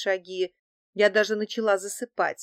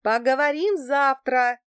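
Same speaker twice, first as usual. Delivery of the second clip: higher, louder call back; raised voice, putting someone off; shouted reply; 6 semitones higher, 6 dB louder than usual. A narrator's reading. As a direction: call back over a distance. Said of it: "в ответ закричала я".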